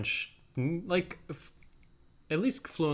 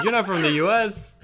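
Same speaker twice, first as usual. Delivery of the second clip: louder, higher disbelief; a hint of amazed shop clerk; gushing just a touch; lightly voiced surprised clerk; 7 semitones higher, 7 dB louder than usual. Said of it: "she's like".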